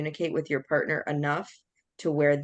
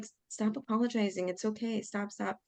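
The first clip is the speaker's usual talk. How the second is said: soft, higher-pitched hushing; retold soothing protest; 5 semitones higher, 6 dB quieter than usual. Speaker to apologizing partner, soft soothing protest. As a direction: gently shush someone who keeps apologizing.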